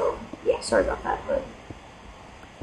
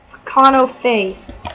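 ordinary talk, nothing marked

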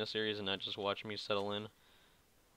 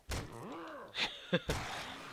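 high squeaky laugh; 5 dB quieter than usual. Real, not acted. Laughs at own phrase.

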